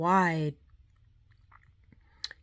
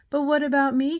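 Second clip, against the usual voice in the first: higher, louder tearful plea; crying out; 7 semitones higher, 6 dB louder, faster than usual. A narrator's reading. Stranded tooth fairy crying to Walter, higher and louder. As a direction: wail it out. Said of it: "cried the Tooth Fairy".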